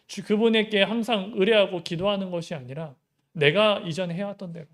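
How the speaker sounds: ordinary talk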